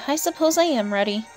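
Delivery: usual speaking voice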